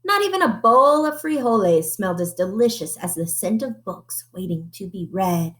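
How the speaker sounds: usual speaking voice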